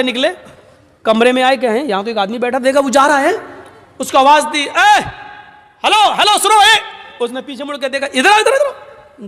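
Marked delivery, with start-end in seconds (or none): 4.6-9.3 much higher calls across the yard; calling out. Retold or imitated. Umar calling the departing young man back, high and carrying. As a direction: hail him back before he leaves.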